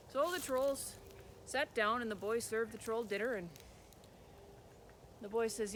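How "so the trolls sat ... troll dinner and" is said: ordinary delivery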